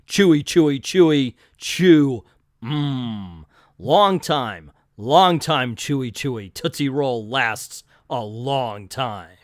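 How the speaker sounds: loud, grave announcer read of a candy jingle; serious narrator voice. Acted. A comic-book reader reading a candy ad in a serious narrator voice. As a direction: read the Tootsie Roll ad like a solemn trailer voice. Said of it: "using the serious narrator voice on that was kinda silly".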